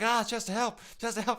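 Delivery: high, loud mock whine for help; whiny plea. Teammate wailing at Chester to save him, high mock whine. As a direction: wail for help like a needy teammate. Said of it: "And you're like".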